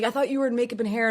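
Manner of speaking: fast, high-pitched rush of alarm; retold freak-out. Mom questioning her child while waiting for help, freaking out. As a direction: imitate a frantic mother, words tumbling out. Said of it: "So she's freaking out".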